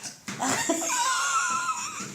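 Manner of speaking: high squealing laugh; tickled shriek of laughter. Real, not acted. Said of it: "elle me fait des chatouilles".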